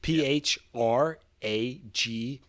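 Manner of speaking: slow, letter by letter; spelling it out slowly. Shown as a demonstration. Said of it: "How do you spell that?".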